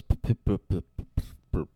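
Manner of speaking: puffing, stuttering engine noise; imitated engine sputter. Man retelling the car dying in water, imitated engine sputter. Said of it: "the fucking thing goes".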